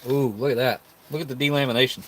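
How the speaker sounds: ordinary talk, nothing marked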